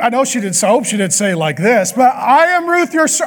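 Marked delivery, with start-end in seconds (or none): 2.1-3.3 higher mock young woman; hammed-up girl voice. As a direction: try the girl voice again, lighter. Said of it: "I don't do a good girl voice".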